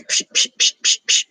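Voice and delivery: breathy psh sounds copying smacks on a sleeping man; imitated slapping noise. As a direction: mimic the taps of a hand slapping. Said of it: "when you smack somebody and you can hear it".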